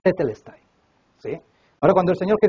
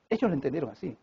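usual speaking voice

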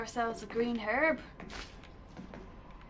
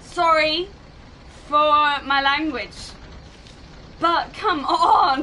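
loud, drawn-out, protesting apology; exasperated apology; 3 semitones higher, 9 dB louder, slower than usual. Real, not acted. Player apologising to viewers for swearing, loud exasperation. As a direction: stretch it out loudly, still worked up.

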